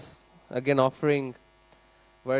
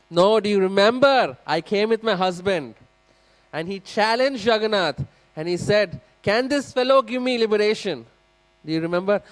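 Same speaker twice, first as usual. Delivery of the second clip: urgent pleading; 7 semitones higher, 7 dB louder than usual. Acted. Widow urging the priest to recall.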